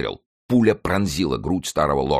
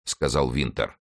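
plain reading voice

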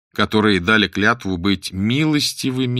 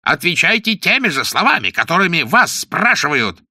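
high, indignant prosecutor's shout; 8 semitones higher, 4 dB louder than usual. A narrator's reading. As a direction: shout an order at the defendant. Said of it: "закричал он". (гневный крик прокурора)

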